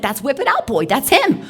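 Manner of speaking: yelling to make him look; loud, high and frantic; urgent shout. Retold or imitated.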